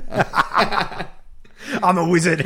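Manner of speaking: slow, laughing movie quote; Harry Potter quote. Retold or imitated. Quoting Harry Potter to tease his co-host, slow and laughing. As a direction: drag out the famous line, laughing. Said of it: "watched Harry Potter".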